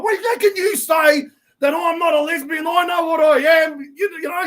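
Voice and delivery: high, loud furious protest; riled-up shout. Retold or imitated. The lady arguing with Bob, shouting high and loud. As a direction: let her boil over, shouting. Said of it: "she's getting riled up".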